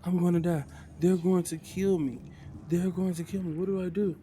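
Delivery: loud, high-pitched panic; completely freaking out. Acted. Kiba sure the goblins will kill him, freaking out. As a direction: cry out in rising desperation. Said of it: "just completely freaking out".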